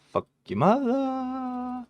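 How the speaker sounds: high, drawn-out mock squeal; silly high voice. Folding a junk hand, mocking it in a slow high squeal. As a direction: stretch it out way up high like a goofy cartoon. Same insult repeated in silly voice.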